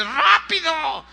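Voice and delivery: high, drawn-out acted strain; straining, grunted plea. Man biting rope to his rescuer, straining grunted plea. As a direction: urge them on as if mouth is clamped on a rope. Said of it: "se oían puros pujidos ... así como diciéndole".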